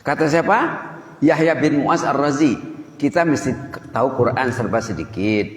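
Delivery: ordinary delivery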